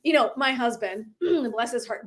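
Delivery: ordinary delivery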